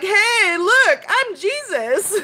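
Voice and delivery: high, hammed-up mock Jesus proclaiming himself; mock shout as a fake Jesus, then slow. Mocking someone dressed as Jesus on Easter, hammed-up high call. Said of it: "being like".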